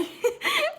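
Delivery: high squealing laugh; squeaky amused laugh. Real, not acted. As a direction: laugh at the idea. Laughing at boiling spruce.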